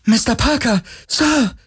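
very high-pitched, elated call; childlike joy. A narrator's reading. Crewwoman calls to her boss across the platform, childlike joy. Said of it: "rief die Terranerin ... mit fast kindlicher Freude".

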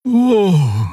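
high startled oh; high surprised gasp of a word; surprised creature. A narrator's reading. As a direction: Xea caught off guard.

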